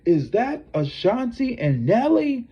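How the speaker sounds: mock arena announcer, pitched high. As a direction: play the game announcer spotting a couple. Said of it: "the spokesman of the game was like".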